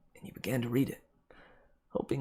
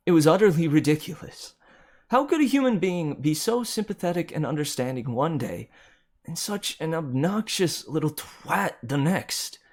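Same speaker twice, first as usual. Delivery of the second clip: louder and higher, incredulous and scornful; raised, indignant narration slowing on the insult; outraged disbelief; 6 semitones higher, 5 dB louder than usual. A narrator's reading. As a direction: spit out the betrayal in disbelief.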